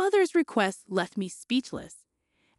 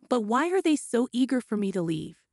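plain reading voice

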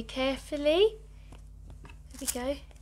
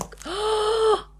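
sharp high gasp of delight; 11 semitones higher, 6 dB louder than usual. Real, not acted. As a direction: gasp in surprise. Feeling the baby.

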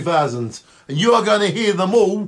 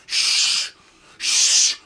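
long unvoiced shush. Host keeping the sausage sandwich secret, shushing. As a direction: hush them with a drawn-out shh.

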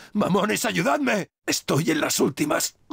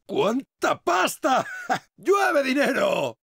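high, drawn-out gleeful shout; elated; 6 semitones higher, much slower than usual. Acted. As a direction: whoop with joy at a windfall. Elated at raining money.

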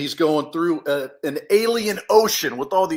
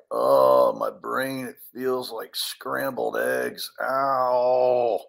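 low, groaning cartoon hero; dazed groan; 7 semitones lower, much slower than usual. Hero lying there after the crash, dazed groaning. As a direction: groan it out like your head aches.

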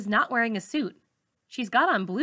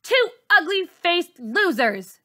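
louder, higher mocking sneer; 8 semitones higher, 5 dB louder than usual. Bully sneering at the girl and teacher as she leaves, loud sneer. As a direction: scorn them on the way out. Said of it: "she says".

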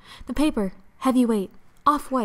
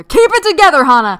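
high, loud urging herself on; loud self-pep talk; 5 semitones higher, 13 dB louder than usual. Acted. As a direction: shout at yourself to hold it together. Hana psyches herself up.

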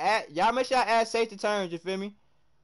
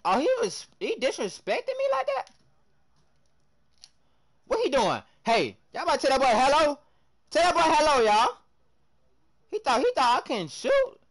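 indignant; 8 semitones higher, 7 dB louder than usual. Real, not acted. Indignant after being disrespected.

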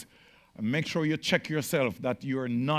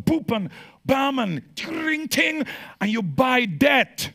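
mock checkout beeps; 11 semitones higher, 7 dB louder than usual. Mimicking card tap noises.

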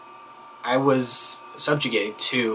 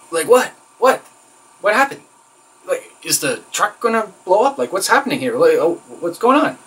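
higher, startled questioning; alarmed bewilderment; 6 semitones higher than usual. Acted. Trainee reacting to trainer's outburst, alarmed and bewildered. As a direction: act out a baffled reaction to being yelled at.

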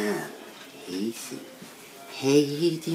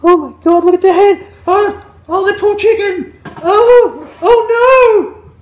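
much higher, louder shrieks of fake shock; mock horror; 10 dB louder, slower than usual. Anchor watches crash footage, shrieking in mock horror. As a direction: shriek at the footage, half laughing.

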